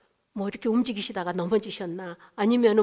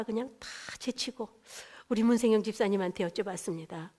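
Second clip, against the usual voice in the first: normal talking voice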